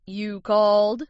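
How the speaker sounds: a touch of a booming hero. A narrator's reading. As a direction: answer a summons with a mild boom. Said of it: "came a booming voice".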